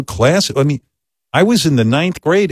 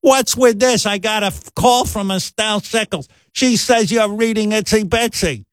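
much higher mock yell of an upset mother; mock screaming mom; 10 semitones higher than usual. Mother yelling at her son over a call, much higher mock yell. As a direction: nag like a furious mom. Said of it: "starts screaming at me".

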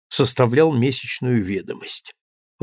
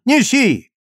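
shouted order; 10 semitones higher, 3 dB louder than usual. A narrator's reading. Lieutenant orders soldiers to bring box.

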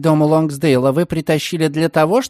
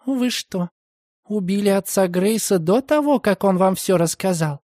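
higher, startled question; shocked young woman; 5 semitones higher than usual. A narrator's reading. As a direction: gasp it out in disbelief. (потрясённый девичий голос)

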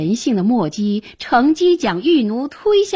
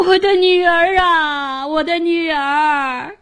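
an old father wailing for his daughter, acted; crying out; 9 semitones higher than usual. A narrator's reading. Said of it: "哭喊着".

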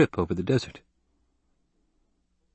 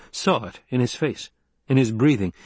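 plain reading voice

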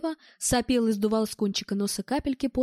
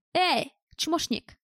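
drawn-out, higher-pitched holler without real volume; calling out; 5 semitones higher, much slower than usual. A narrator's reading. Bald gunman hailing someone through the doorway, shouting. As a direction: call roughly to someone at a distance. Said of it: "крикнул Лысый".

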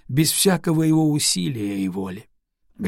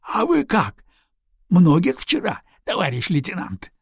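higher, lighter curious question; almost cheerful; 5 semitones higher than usual. Acted. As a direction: ask it brightly, nearly cheerful. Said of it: "спросил почти весело".

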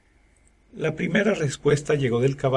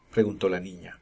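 plain reading voice

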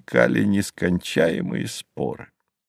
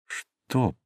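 softly voiced, wary question to a ghost; hushed questioning; 7 dB quieter than usual. A narrator's reading. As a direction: ask it under your breath. Narrator questions the silent ghost.

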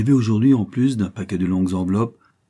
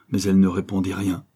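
ordinary delivery

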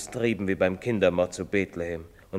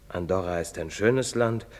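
ordinary talk, nothing marked

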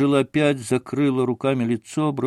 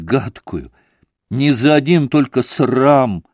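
higher, louder heartfelt outpouring; fervent confession; 5 semitones higher, 5 dB louder than usual. Acted. Kneeling woman confessing to Alyosha, fervent and raised. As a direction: pour it all out to him.

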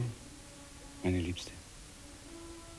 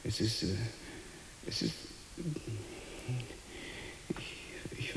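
bewildered whisper; 12 dB quieter than usual. Acted. Mann flüstert ratlos.

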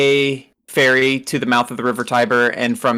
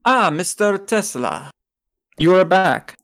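put-on German accent; 4 semitones higher than usual. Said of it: "how to do a German accent".

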